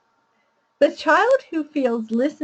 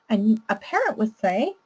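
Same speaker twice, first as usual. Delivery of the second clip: usual speaking voice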